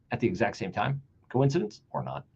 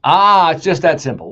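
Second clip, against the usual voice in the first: mocking; 10 dB louder than usual. Mocking Cuban's clip.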